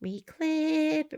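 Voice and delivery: "Reclip" lifted high and drawn out; sing-song reclip. Real, not acted. Quilter sings the word to herself while clipping, lilting. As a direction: chirp the word "Reclip" up high as you clip.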